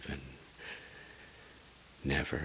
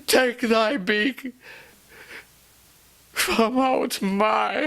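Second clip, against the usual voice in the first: high loud slow sobbing shout; crying scream; 16 dB louder than usual. Acted. Lover screaming at the raven to leave his door. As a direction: drive the bird out with your voice.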